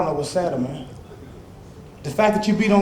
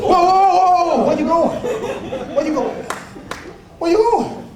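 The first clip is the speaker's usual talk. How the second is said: higher, laughing, goading him to fight; high mocking taunt; 12 semitones higher than usual. Man goading his scared friend, high mocking laughter. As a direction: laugh at him and dare him to stay.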